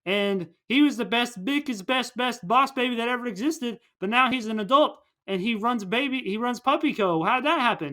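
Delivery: high-pitched, excited run-on amazement; mock astonished retelling. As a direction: gush the backstory in disbelief. Retold characters' astonished reaction.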